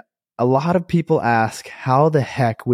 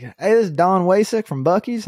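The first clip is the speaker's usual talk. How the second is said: higher-pitched imitation of a Southern accent; 7 semitones higher than usual. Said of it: "big ol' Southern accent".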